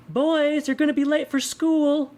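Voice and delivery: very high, lilting sing-song call; sing-songy. A narrator's reading. Mother calling her boys in her commercial line, sing-songy. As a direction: sing it out high, holding the notes. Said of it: "in a sing-songy voice".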